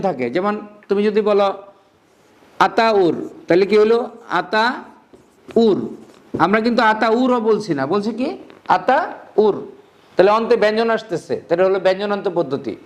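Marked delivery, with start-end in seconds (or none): 4.4-5.8 "Ataur" slowly as আতা, then উর; long break inside "Ataur" before উর; split into big chunks. Shown as a demonstration. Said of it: "বড় করে ভাঙবে". 8.8-9.5 "Ataur" as আতা, pause, উর; two separate beats in "Ataur": আতা, উর; split into big chunks. Shown as a demonstration. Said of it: "বড় করে ভাঙবে".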